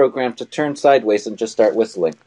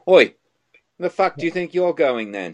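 a hint of a rough British accent, higher; robot hassling someone in a British accent; 6 semitones higher than usual. Mock British guard robot, lightly voiced. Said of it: "Teach it to talk in a British accent".

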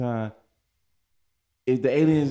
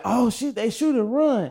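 mock panic; 10 semitones higher than usual. Everybody panicking in war zone.

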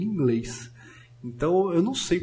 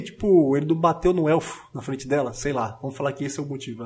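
ordinary talk, nothing marked

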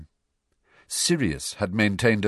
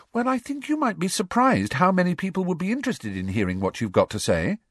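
earnest, higher Hermione voice; serious; 12 semitones higher than usual. A narrator's reading. Hermione seriously urging Harry, earnest and higher. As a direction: make him believe it. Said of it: "said Hermione seriously".

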